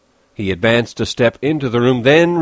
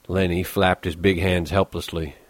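usual speaking voice

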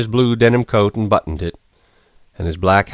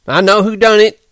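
high, loud accusing cry; Curly bursting out, high and loud; cried out; 13 semitones higher, 6 dB louder than usual. A narrator's reading. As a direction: yell it out as he bursts to life. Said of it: "he cried".

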